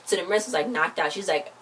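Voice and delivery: ordinary delivery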